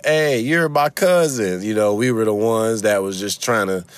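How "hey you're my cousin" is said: high, drawn-out "hey" to a famous cousin; hammed-up pushy family greeting; eager relative. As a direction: hail a long-lost cousin. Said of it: "I think my family was the".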